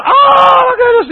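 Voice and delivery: piercingly high, loud squeal; hammed-up excited falsetto; ladies' greeting squeal. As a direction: shriek with mock delight at seeing a friend. Imitates ladies greeting with a hug.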